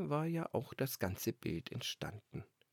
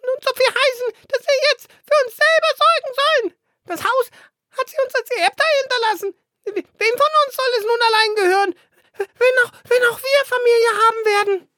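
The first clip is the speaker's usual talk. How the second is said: very high, loud little bird voice; squeaky sparrow; 15 dB louder, faster than usual. Acted. Young sparrow complains to his brothers, very high squeaky bird voice. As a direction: chirp it out like a fledgling sparrow.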